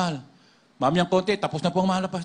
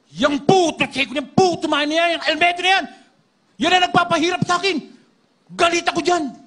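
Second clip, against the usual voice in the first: shrieked much higher and louder; a possessed voice spitting hatred; raging demon; 12 semitones higher, 7 dB louder than usual. Retold or imitated. Demon raging against the pastor. (demonyong nagwawala, sumisigaw sa galit)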